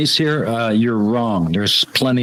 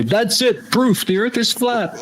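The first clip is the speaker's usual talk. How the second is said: high-pitched, slowing for the punchline; mock triumphant discovery; 8 semitones higher, slower than usual. [name] looking at the grass, mock-triumphant. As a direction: announce it as hammed-up proof.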